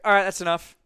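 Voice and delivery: loud, curt brush-off from the judge; dismissive cutoff. Retold or imitated. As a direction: cut him off loudly, bored. Dismissive tryout judge quoted.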